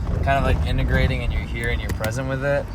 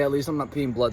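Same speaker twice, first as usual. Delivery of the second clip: ordinary talk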